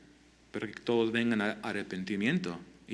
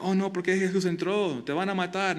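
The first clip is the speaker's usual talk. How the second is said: mock worried; 7 semitones higher than usual. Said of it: "A veces piensa".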